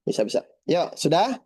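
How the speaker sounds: ordinary talk, nothing marked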